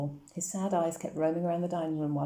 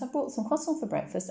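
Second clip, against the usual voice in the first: much higher, eager announcement; excited girl; 8 semitones higher than usual. A narrator's reading. Anna telling Papa about breakfast, much higher and excited. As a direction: show off the croissants with excitement. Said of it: "cried Anna".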